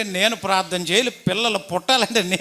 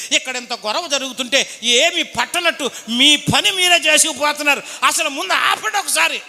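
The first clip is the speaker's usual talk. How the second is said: agitated complaint; 7 semitones higher than usual. Retold or imitated. Groom's uncle runs up shouting.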